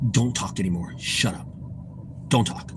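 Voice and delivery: low, soft aside as if behind a customer's back; hushed order. Retold or imitated. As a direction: imitate a furtive order to a coworker. Furtive order while customer turns away.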